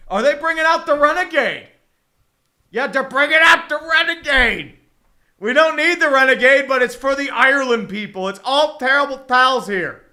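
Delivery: loud, high-pitched hammed-up holler; hammed-up outburst. Streamer reacting to the Renegade coming out, hammed-up holler. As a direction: holler it out like a rowdy rally cry.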